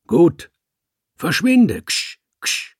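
viel höher und lauter anfauchen; angry hissing. A narrator's reading. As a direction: die Katze wie ein Kater verscheuchen. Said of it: "fauchte er mich an". (der Dichter faucht den Kater an, laut und scharf)

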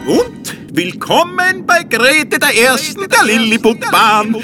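high, hammed-up fairground barker; market crier. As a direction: call it out to the whole fair. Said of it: "schmetterte der Wurstel lauthals wie ein Marktschreier heraus".

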